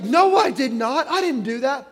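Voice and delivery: loud denial. Retold or imitated. Daughter denies it.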